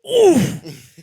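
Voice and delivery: loud high grunt of a hit to the ribs; pained oof. Retold or imitated. Boxer hit in the ribs, loud pained grunt. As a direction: let out a winded grunt like taking a punch. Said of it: "you can hear Pesci in the movie go".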